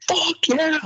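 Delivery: high lifting-platform yell; retold scream. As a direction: belt it out after a huge squat. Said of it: "I scream".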